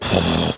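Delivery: long, blown-out exhale; breathing through it. Retold or imitated. Dad facing his son's tantrum, breathing through it. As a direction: demonstrate a slow, steadying breath out. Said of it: "just breathing through it".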